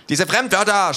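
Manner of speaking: high, loud angry yell at foreign words; the little thug screams in disgust; shouting. A narrator's reading. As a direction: scream it out, outraged. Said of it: "schreit er".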